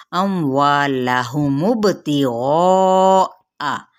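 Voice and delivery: drawn out, chanted recitation of the word; slow Quran recitation. Shown as a demonstration. Teacher reciting a Quran word for students, slow and chanted. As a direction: recite it slowly and melodically, stretching the vowels.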